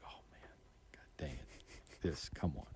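whispered, muttered to himself under his breath; dismayed whisper. Retold or imitated. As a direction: whisper the groan so the trooper can't hear. Retold dismay at getting pulled over.

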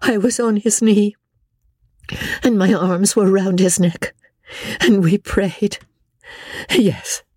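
high, wild, distraught voice; lost in grief, half out of her mind; wilder tone. A narrator's reading. Amelia raves to Rebecca about George, wild and high. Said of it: "she continued in a wilder tone".

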